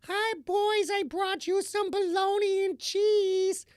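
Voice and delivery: put-on very high, squeaky old lady voice; little high squeaky voice. Mother serving snacks to the boys, high squeaky voice. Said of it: "with this really little high squeaky voice".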